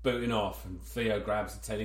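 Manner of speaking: usual speaking voice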